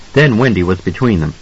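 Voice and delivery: ordinary delivery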